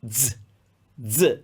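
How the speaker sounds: "z" as a buzzed "dz", as in "adds"; voiced DZ sound. Shown as a demonstration. Teacher showing second sound of Z, "z" said as "dz". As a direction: start "z" with a d, then buzz. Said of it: "like when we say he adds".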